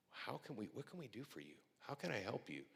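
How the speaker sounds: soft, caring demo of offering help; gentle offer. Shown as a demonstration. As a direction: ask it like you truly want to help. Showing how to greet kindly.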